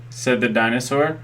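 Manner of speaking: plain reading voice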